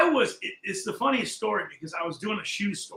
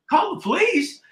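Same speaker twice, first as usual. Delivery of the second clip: disbelief; 12 semitones higher, 6 dB louder than usual. Retold or imitated. Incredulous retold reply to partner.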